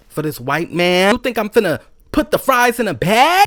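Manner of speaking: building indignation; angry rant. Retold or imitated. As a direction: vent with rising fury. Venting about bagging food.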